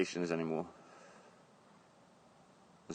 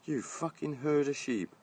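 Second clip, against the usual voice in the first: slow, pitched-up jeering yell at the shoppers; a slowed, high, half-shouted insult; retold shout; 8 semitones higher, slower than usual. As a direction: drag it out, mock-yelling at the queue. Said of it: "shouted, I said".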